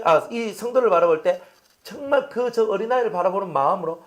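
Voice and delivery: normal talking voice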